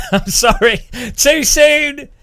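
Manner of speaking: laughing. Real, not acted. Laughing at own tasteless joke.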